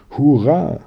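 high, loud hurrah; excited cheer. A narrator's reading. Pony riding off on her chair, excited cheer. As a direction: cheer it out in delight. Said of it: "rief Pony Hütchen".

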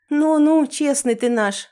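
high, loud amused teasing; smiling tease. Acted. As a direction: tease him with a smile. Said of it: "улыбнулась я".

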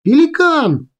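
very high, drawn cry of a callsign; cried call. A narrator's reading. Girl risking tribunal to warn bombers, urgent cry. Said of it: "кричит".

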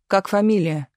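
plain reading voice

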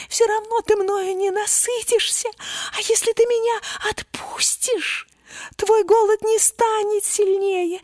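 very high squeaky mouse voice; tiny mouse voice. A narrator's reading. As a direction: speak as a small earnest mouse. Mouse keeps pleading.

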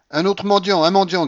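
high, loud shout; crying out. A narrator's reading. Grandmother spots another beggar, shouting. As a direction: call out loudly, twice over. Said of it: "criait la babouchka".

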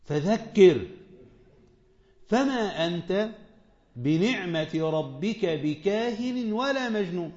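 slow, measured chanting of the verse; Quran recitation. Acted. Preacher recites the verse to his listeners, slow and measured. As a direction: recite each word slowly and reverently.

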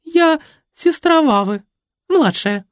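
high, slow, coy claim; flirty lie.

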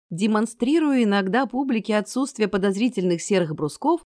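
plain reading voice

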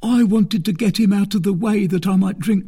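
high-pitched, fearful confession; terrified. A narrator's reading. Fourreau admitting he meant to drink, terrified. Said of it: "whose teeth chattered with terror".

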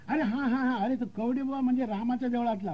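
high, rustic burst of recognition; remembering local. Acted. Local recalling the Ram temple, high-voiced recognition. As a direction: pitch it up as memory returns.

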